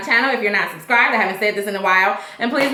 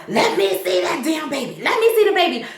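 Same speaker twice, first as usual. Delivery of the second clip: insistent, higher as it goes; demanding; 4 semitones higher than usual. Retold or imitated. Eddie at the hospital demanding to see the baby, insistent. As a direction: push hard, he won't take no. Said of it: "He's like".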